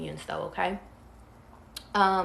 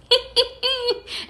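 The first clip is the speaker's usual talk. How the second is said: high giggle; 6 dB louder than usual. Real, not acted. Laughing at her own joke.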